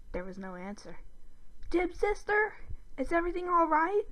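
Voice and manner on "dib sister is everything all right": high, put-on questioning voice; Zim calling out. A narrator's reading. Boy calling downstairs to his friend's sister, high and tentative. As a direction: call out tentatively in a high-pitched character voice. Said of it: "Zim called next".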